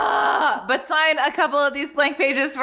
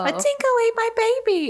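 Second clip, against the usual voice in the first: mock Elaine; 11 semitones higher than usual. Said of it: "I saw it on fucking Seinfeld".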